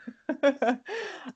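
high breathy laugh. Real, not acted. Laughing at own joke.

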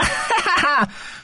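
high mocking laugh; villain's high gloating laugh; gloating cackle. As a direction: cackle in triumph. Villain laughs after taunt.

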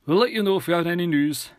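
put-on reassuring officer addressing a worried boy; police officer. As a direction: put on an official's lifted, firm voice. Officer Roebuck's voice.

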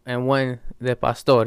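Spanish taco order, measured and deliberate; Spanish accent. Acted. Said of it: "throw in the English and Spanish".

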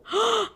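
high, sharp intake of breath; shocked gasp. Real, not acted. Co-host hears the mom's age, shocked gasp.

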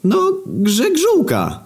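very high, drawn-out put-on voice; dim-witted Grzegżu. Grzegżu butting in with his surname, high slow put-on voice. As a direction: stretch it out high as the clueless buddy. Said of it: "Teraz wchodzi Grzegżu".

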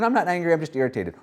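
a denying voice, high on not; lightly voiced "I'm not angry"; mock excuse. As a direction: imitate someone brushing off anger.

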